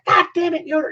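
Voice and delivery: exasperated high yell; Dick Adams. Retold or imitated. Coach losing patience with the freshman, exasperated scream. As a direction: snap from praise into a high scolding. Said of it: "then he'd scream at him".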